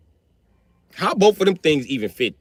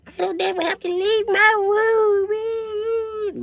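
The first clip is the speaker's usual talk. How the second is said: put-on high, slow whiny teen voice; much slower than usual. Teenage daughter whining about staying in her room. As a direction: whine it like a moody teen. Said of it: "where they be like".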